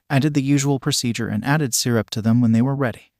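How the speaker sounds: plain reading voice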